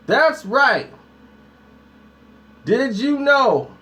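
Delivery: dramatic video-intro delivery; hyped presenter. Real, not acted. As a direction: tease the topic like a show host.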